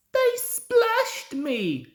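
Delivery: very high, slow, wavering excuse; faltering plea. A narrator's reading. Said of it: "faltered Bert".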